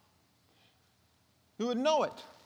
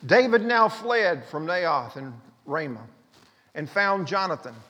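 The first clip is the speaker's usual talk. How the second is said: ordinary delivery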